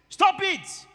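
high, loud stop-command to the congregation; sharp rebuke. Real, not acted. Preacher rebukes wrong confession.

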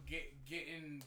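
normal talking voice

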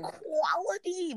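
quality stretched slow and high; drawn-out quality. Real, not acted. To cohost, bragging with a slow drawn quality. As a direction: savour the word quality slowly. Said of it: "I have dated quality".

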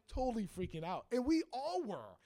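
ordinary talk

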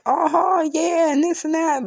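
high-pitched, breezy crowd-working patter; animated chatting. Acted. Imagining a room full of people, animated and chatty.

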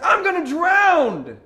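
high, drawn-out panicked yell; drowning scream. Acted. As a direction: scream it long and loud, in terror. Peter screaming while drowning.

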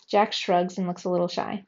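ordinary delivery

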